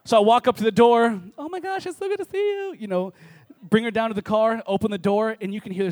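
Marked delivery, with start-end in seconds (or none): 1.4-2.8 very high mock-excited squeal; gushing greeting. Greeting at the door on a first date, high gushing. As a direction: gush it in a high put-on voice.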